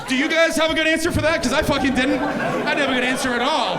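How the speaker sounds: high-pitched, worked-up protest; exasperated outburst. Acted. Comic admits to audience he lost the debate, exasperated. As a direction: appeal to the crowd, thoroughly stumped.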